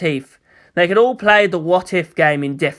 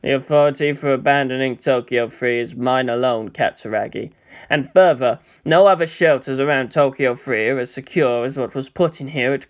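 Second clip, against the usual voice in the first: cold commander; 5 semitones lower than usual. Acted. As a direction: lay down the law calmly. Cold commander voiced low.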